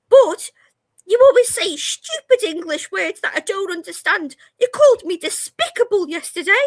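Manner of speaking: high, loud whiny outburst; mock teen rant. Daughter ranting at her mum, high whiny outburst. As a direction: imitate the sulky daughter ranting. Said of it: "a little mini quiet outburst".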